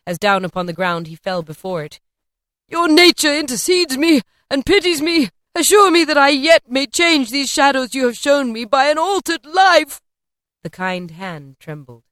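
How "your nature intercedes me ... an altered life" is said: higher, loud desperate begging; fervent pleading. A narrator's reading. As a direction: beg for mercy with all you have. Scrooge begging on ground.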